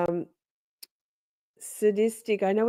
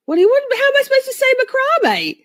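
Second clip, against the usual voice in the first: mock outrage; 5 dB louder, faster than usual. Mock outrage at chat's teasing.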